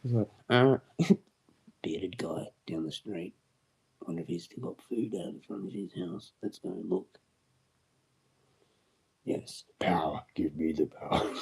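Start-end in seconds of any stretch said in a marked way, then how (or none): 1.8-5.4 hushed whisper. Pretend. Voicing townsfolk in hushed tone. 9.2-11.3 lower, whispered power-hungry villain; mock villain. Playing villain gaining power.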